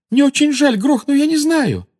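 loud, very high-pitched panic; shrieked, frightened apology; terrified squeal. A narrator's reading. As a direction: plead frantically at the top of the voice. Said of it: "взвизгнула насмерть перепуганная Гермиона".

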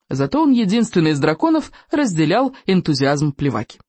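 plain reading voice

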